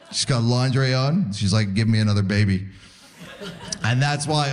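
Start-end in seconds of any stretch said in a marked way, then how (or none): 1.7-2.7 low, husky come-on; low, hammed-up bedroom voice; mock sultry wife. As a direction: play the seductive wife, deep. Wife voiced seductively in lingerie.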